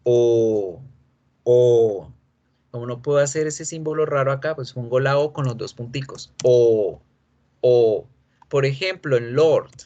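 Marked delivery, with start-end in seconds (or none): 0.0-2.1 O held long and round, no r; long O sound. Shown as a demonstration. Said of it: "vamos a producir el sonido de O". 6.4-8.1 O held long and round, no r; long O sound. Shown as a demonstration. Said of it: "el sonido de O".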